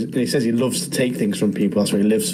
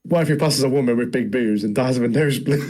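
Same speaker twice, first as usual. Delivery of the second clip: normal talking voice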